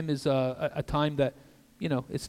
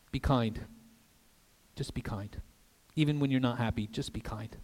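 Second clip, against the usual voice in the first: soft, low and gentle; gentle urging; 4 semitones lower, 7 dB quieter than usual. Real, not acted. Preacher urging congregation to just be kind, softly. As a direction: let it drop to a hushed, tender plea. Tender repeated urging of kindness.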